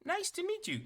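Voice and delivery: very high, loud robot voice; Bebop. Acted. Robot greeting Neff after handshake, high robot voice. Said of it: "Bebop turns a".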